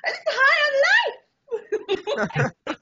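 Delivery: put-on loud, high, chirpy drunk excuse; mock cheerful shout. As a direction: belt it out like a giddy drunk.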